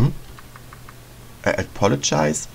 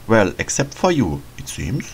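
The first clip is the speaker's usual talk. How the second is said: ordinary talk, nothing marked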